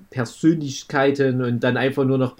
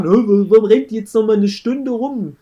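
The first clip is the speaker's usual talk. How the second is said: mock complaining viewer; 7 semitones higher, 4 dB louder than usual. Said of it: "wo sich viele drüber beschweren".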